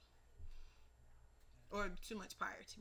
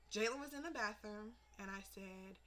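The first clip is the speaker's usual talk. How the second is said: ordinary talk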